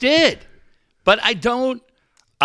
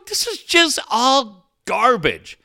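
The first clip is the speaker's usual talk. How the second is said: dismissive mock voice; 10 semitones higher, 3 dB louder than usual. Mocking others' reply.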